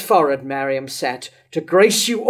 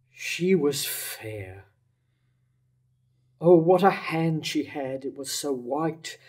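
stunned grief; 5 semitones lower, 9 dB quieter than usual. Acted. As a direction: let the words barely leave you, numb with loss. Herod hears Mariam is dead.

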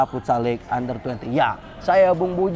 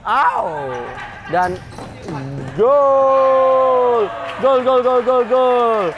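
long, high, shouted goal call; 7 semitones higher, 3 dB louder, much slower than usual. Real, not acted. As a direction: roar the goal with rising excitement. Goal scored in futsal commentary.

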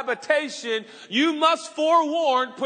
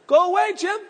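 higher, louder playful shoo; mock brush-off; 7 semitones higher, 5 dB louder than usual. Pastor pretending to send away friend Jim, playful and loud. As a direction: shoo off the friend, half joking.

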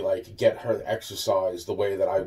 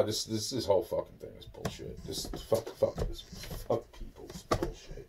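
muttered under the breath, nearly voiceless; voiceless, disgusted aside; bitter mutter; 2 semitones lower, 9 dB quieter than usual. Real, not acted. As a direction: grumble it to yourself in disgust.

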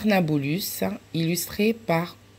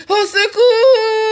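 much higher, louder, slow scream; cry for help; 7 dB louder, slower than usual. Acted. Marion screaming at the bumblebee, panicked shout. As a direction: scream it, scared of the bee. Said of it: "Elle a crié".

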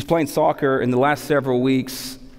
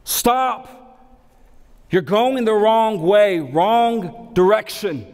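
urgent warning; 7 semitones higher, much slower than usual. Retold or imitated. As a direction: call them back with urgency. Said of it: "So Paul's saying".

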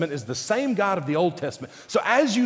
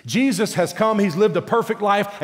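usual speaking voice

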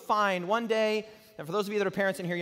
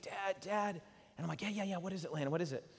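hushed tent voices; 7 dB quieter, faster than usual. Retold or imitated. Father and son whisper in tent.

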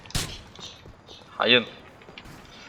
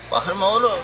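louder irritated order to stop shouting; loud snap; 9 semitones higher, 6 dB louder than usual. Real, not acted. Gamer telling the screaming passenger to shut up, loud.

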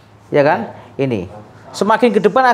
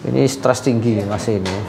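ordinary talk, nothing marked

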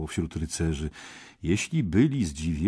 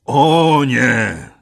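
booming loud, much higher cry; thundering voice; 7 semitones higher, 13 dB louder than usual. A narrator's reading. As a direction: thunder it out like a giant knight. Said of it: "swoim grzmiącym głosem".

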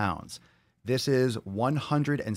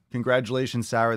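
normal talking voice

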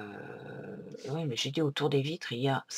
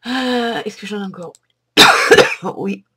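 loud, higher, urgent search; panicking under attack, louder; loud anxious question; frantic; 5 semitones higher, 17 dB louder than usual. Real, not acted.

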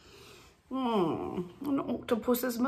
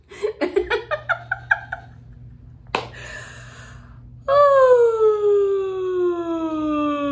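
high laughter, then a long loud falling "oh"; laughter into yawn; 11 semitones higher, 5 dB louder than usual. Real, not acted. Laughter leader modeling after laughing, laughing into a yawn. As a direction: laugh out, then release a big descending yawn.

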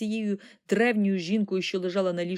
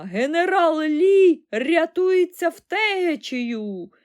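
high, loud, drawn-out holler; triumphant old woman's yell; exclaiming old woman; 9 semitones higher, 8 dB louder, much slower than usual. A narrator's reading. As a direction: shout the rout excitedly, slowly stretched. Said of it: "вигукнула вона".

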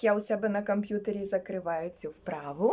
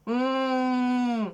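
long, steady "mmm"; pondering hum. Acted. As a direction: hum as if thinking it over. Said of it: "Потім він задумався". (задумливе протяжне «м»)

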